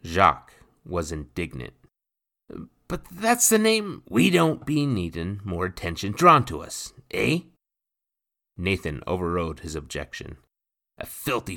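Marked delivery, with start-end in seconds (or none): 2.9-4.0 put-on much higher, fast boyish voice; a startled lad blurting out; young boy Jacques. A narrator's reading. Indignant Jacques. 4.1-7.4 lightly voiced gruff pirate, a touch louder; rough pirate voice. A narrator's reading. Nathan overrides.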